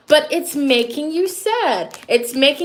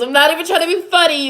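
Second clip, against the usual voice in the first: higher, faster "I'm not even trying to be funny", half-laughing; emphatic repeat; 5 semitones higher, 3 dB louder, faster than usual. Real, not acted. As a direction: rush it out, insisting.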